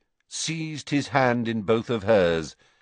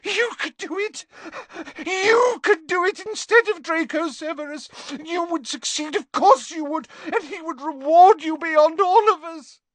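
high, loud, desperate begging; gasped plea; 5 dB louder than usual. A narrator's reading. Narcissa begging Snape to do it instead, high and frantic. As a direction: clutch at him with words. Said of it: "she gasped".

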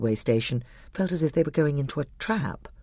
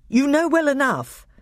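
much higher, louder protest; higher indignant king voice; high voice of the young king; 14 semitones higher, 5 dB louder than usual. A narrator's reading. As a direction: insist like a stung young king.

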